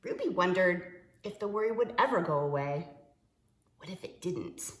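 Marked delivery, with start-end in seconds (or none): none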